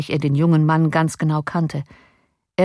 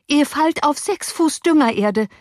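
high-pitched, anxious urging; urgent insistence; 10 semitones higher than usual. A narrator's reading. Nurse persuading master to jump, urgent insistence. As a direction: insist in alarm.

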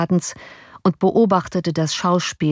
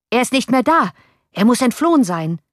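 angry; 7 semitones higher than usual. A narrator's reading. Enraged man insists the prisoner has fled, angry raised voice. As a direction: press it home in fury. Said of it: "wiederholte der wütende Mensch".

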